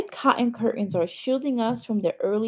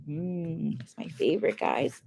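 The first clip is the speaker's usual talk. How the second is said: soft aside; 5 semitones lower, 7 dB quieter than usual. Real, not acted. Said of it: "narrator aside to listeners".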